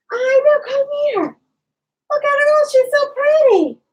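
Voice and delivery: high, sing-song coo at the pets; cooing pet talk. Real, not acted. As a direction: gush over the kitten in a high voice. Cooing over the new kitten.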